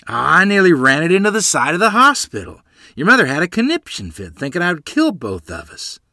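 high, loud boasting; bragging. A narrator's reading. Father boasts of his wild driving, bragging loudly. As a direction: crow about it at full voice. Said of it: "Father bragged".

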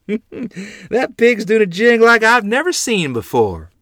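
high, loud and amused through laughter; laughing at the drunk pigs. Acted. Grandmother pointing at the staggering hog, laughing. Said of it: "a tiny ripple of laughter".

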